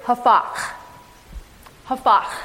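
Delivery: hafach with a throaty kh at the end; preacher teaching the Hebrew word, careful pronunciation. Shown as a demonstration. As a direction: say hafach as the Hebrew, guttural final h. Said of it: "in Hebrew is hafach".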